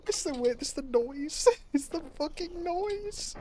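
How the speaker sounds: words squeezed out through laughter; laughing while talking. Real, not acted. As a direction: talk through real giggles. Laughing at game noise.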